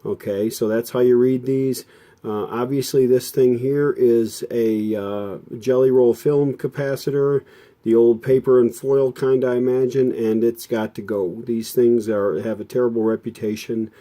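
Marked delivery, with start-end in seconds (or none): none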